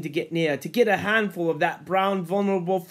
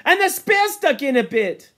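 loud, high and eager; excited boast; 11 semitones higher, 6 dB louder than usual. Acted. Ralph telling of his spear throw, loud excitement. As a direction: insist on it proudly.

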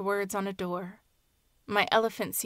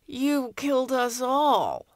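slow, higher, louder anguished moan; 5 semitones higher, 4 dB louder, slower than usual. Acted. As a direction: wail it out like a lament. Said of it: "All Sorts Sita moaned".

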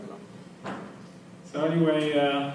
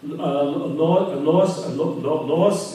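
halting, repeated broken syllables; imitated stutter; 4 dB louder, much slower than usual. Second guy answers the barmaid's question, stuttering. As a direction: act stuck on the start of the word. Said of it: "she sees they're stuttering".

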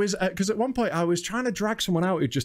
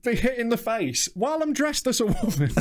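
ordinary delivery

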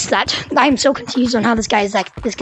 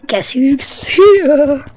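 slow, louder spooky sing-song; creepy taunt; 3 semitones higher, 5 dB louder, much slower than usual. Pretend. Teasing the other gorillas as he sneaks in, slow eerie taunt. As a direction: drag each word out like a lurking villain.

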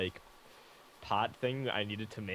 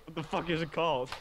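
higher-pitched mock bafflement; exasperated confusion; 8 semitones higher than usual. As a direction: act out being baffled, pitch jumping up. Acting out own confused reaction.

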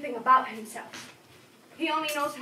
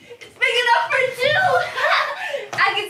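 higher, louder, bursting into a laugh; laughing delight; 10 semitones higher, 9 dB louder than usual. Acted. Child delights telling visitor box fits two, laughing. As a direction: shout it out then giggle.

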